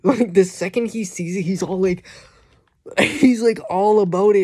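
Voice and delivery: amused excited rant; laughing excitedly. Real, not acted. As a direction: giggle while describing his greed. Laughing about greedy dragon.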